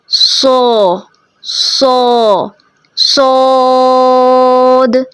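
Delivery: सो, सो, then सोद held long and slow with the mouth only slightly open; teacher demo of सोद. Shown as a demonstration. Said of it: "थोड़ा खोलेंगे".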